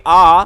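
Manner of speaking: long open आ held clearly; vowel demo. Shown as a demonstration. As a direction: sound out the vowel आ slowly. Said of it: "जब आप आ का उच्चारण करोगे". (स्वर आ का स्पष्ट उच्चारण)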